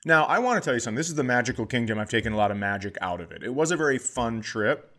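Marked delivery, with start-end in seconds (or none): none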